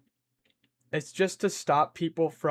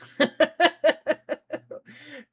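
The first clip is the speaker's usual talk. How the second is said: high giggle fit, louder; loud high laugh; 11 semitones higher, 7 dB louder than usual. Real, not acted. Streamer laughing at how she said hi, high and loud. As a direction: crack up at your own voice.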